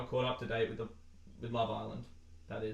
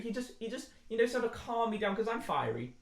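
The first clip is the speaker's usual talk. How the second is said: mock Faye voice; 9 semitones higher than usual. Voicing Faye describing herself.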